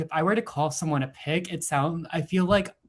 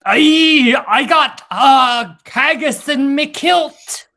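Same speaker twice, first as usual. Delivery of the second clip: loud, high, hammed-up Scottish brogue; over-the-top mock Scottish yell; bad Scottish accent; 11 semitones higher, 11 dB louder, much slower than usual. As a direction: shout it like a cartoon Scotsman. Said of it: "Did I sound like Shrek?".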